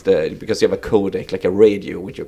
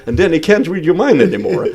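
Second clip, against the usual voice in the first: laughing while telling it; 8 semitones higher, 5 dB louder, faster than usual. Real, not acted. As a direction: chuckle through the words. Laughing at a funny memory.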